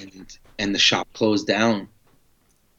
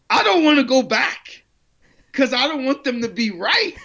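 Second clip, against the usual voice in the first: laughing mock reluctance. Retold dread of admitting they're right.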